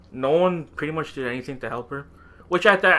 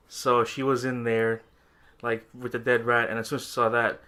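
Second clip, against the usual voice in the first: usual speaking voice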